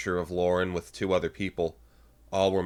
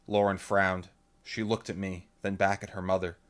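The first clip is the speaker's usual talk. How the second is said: usual speaking voice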